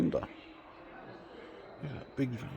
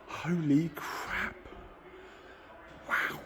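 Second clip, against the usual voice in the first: slow, higher, hushed awe; awestruck; 5 semitones higher, 7 dB quieter, much slower than usual. Real, not acted. Stunned by his childhood dream car, slow hushed awe. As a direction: let the sight steal your breath.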